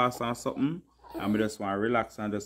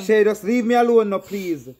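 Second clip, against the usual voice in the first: loud exasperated leave me alone; fed-up plea to be left alone; 8 semitones higher, 7 dB louder than usual. Real, not acted. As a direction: shout him off, fed up. Annoyed at being filmed.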